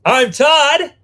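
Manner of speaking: loud, high-pitched host introduction; announcer shout. Pretend. Host introducing himself to viewers, announcer shout. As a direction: sing out your name, show-opener style.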